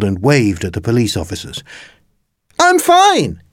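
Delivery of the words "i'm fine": put-on high boy's call back; shouted. A narrator's reading. Jake answering police from the classroom, high shout. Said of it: "he shouted".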